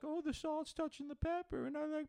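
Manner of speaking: very high, squeaky-pitched parody; mocking picky eater. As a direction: trade fussy complaints in a falsetto. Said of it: "killing each other back and forth".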